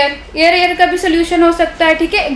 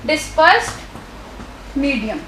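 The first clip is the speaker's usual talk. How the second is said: slow dictation; 3 semitones lower, 6 dB quieter, much slower than usual. Shown as a demonstration. Teacher dictating a term.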